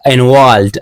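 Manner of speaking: "involved" said in place of invalid; mispronounced invalid. Shown as a demonstration. Learner recalling an old mistake, mispronouncing invalid as "involved". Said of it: "I pronounced invalid like involved".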